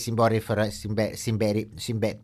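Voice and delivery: stumbling word. Real, not acted. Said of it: "κάποιες λέξεις δεν με χωνεύουνε".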